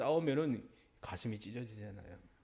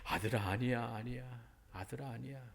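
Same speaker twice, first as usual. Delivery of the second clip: soft, fading gentle reassurance; tender father; 6 dB quieter than usual. Retold or imitated. Father gently calming his angry eldest son, soft and tender. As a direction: soothe him quietly like a loving father. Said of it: "자상하게 말했습니다".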